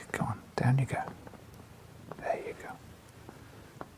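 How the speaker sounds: hushed, gentle command to a pet; coaxing whisper. Real, not acted. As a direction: softly coax the dog to sit. Settling the dog on lap.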